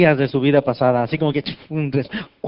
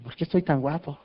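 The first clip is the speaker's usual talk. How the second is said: mock vanity; 7 dB quieter than usual. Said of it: "Y digo".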